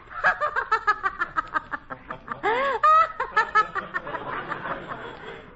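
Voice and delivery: high, drawn-out theatrical laugh; hammed-up rolling laugh; long forced stage laugh. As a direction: laugh bravely like a melodrama heroine.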